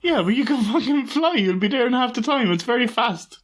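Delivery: high, animated outburst; excited. Real, not acted. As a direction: push it up with excitement.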